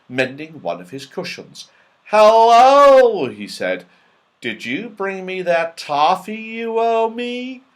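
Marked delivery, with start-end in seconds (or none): 2.1-3.3 hearty hello, high and loud; loud greeting. A narrator's reading. Moonface greets loudly. 4.4-7.6 higher, playful character voice; Moonface's voice. A narrator's reading.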